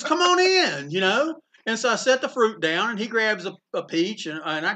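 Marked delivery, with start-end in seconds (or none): 0.0-0.9 much higher, lightly voiced old man; imitating Eisner. Opening his hotel door to John, Eisner greets him in a lifted voice. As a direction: lift the voice way up in a warm greeting.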